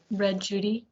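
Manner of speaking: plain reading voice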